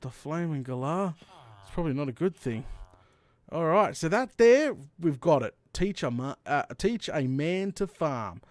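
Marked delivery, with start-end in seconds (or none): none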